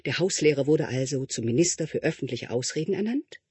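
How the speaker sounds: plain reading voice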